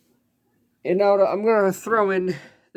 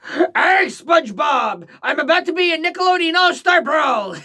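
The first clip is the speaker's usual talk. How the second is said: Plankton voice; 9 semitones higher than usual. Pretend. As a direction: yell it like a tiny scheming villain. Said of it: "I can't do Plankton's voice".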